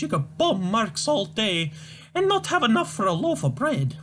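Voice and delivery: put-on high, wailing, scolding old mother voice; shrill old Scottish mum. Mother wails at son Tavish, high despairing scold. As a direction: play the despairing mother crying to the Lord.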